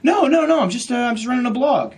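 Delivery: high-pitched, loud protest; acted panicky backpedaling; flustered denial. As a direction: blurt it out high and defensive. Hastily denies provider's accusation.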